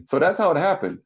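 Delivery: normal talking voice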